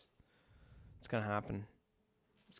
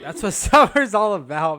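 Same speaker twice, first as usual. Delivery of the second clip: laughing through the words; cracking up mid-sentence; 7 semitones higher, 17 dB louder than usual. Real, not acted. Laughing while saying it.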